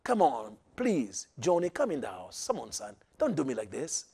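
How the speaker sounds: soft, low, begging father; father quietly begging his son to come in. Acted. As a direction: coax the boy in gently, almost pleading under the breath. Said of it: "He was begging".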